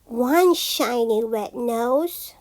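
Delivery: plain reading voice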